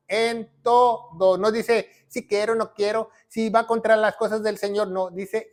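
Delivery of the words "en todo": "en todo" very slow, syllable by syllable; "en... to... do", deliberately spaced; emphatic stress. Shown as a demonstration. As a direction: spell out "en todo" in separate drawn-out beats. Repeats scripture's words for emphasis.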